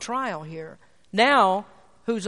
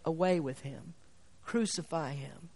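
much softer, lower grave echo of the mob; hushed mock crowd; 4 semitones lower, 9 dB quieter than usual. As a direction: say "Away with him" quietly and solemnly. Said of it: "will you say".

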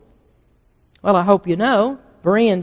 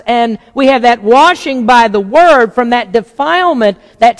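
louder, higher preaching that builds to a pulpit peak; full-force preaching; 4 semitones higher, 5 dB louder than usual. Acted. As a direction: drive the point home to the congregation.

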